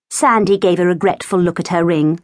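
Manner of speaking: usual speaking voice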